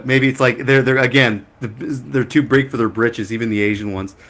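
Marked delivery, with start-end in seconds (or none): none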